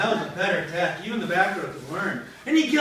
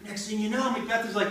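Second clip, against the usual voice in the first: ordinary delivery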